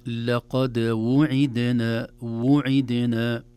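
وعدنا slowly, the waw rounded then a clear kasra on the ain; careful tajweed. Shown as a demonstration. Sheikh shows students how to say وعدنا. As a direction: pronounce وعدنا slowly and separately. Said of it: "تحتاج إلى عناية أيضًا".

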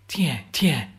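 hushed unvoiced whisper, barely breathed. A narrator's reading. Soldier alerting comrades to an incoming shell, whispered.

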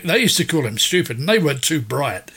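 ordinary talk

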